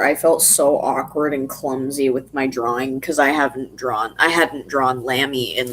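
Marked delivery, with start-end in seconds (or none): none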